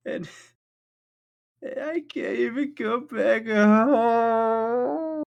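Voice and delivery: wailing plea. Acted. GM voices upset girl pleading.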